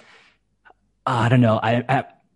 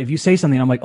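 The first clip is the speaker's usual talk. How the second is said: ordinary delivery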